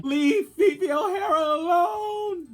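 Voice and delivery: high squeal. Retold or imitated. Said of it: "I would've looked around and be like".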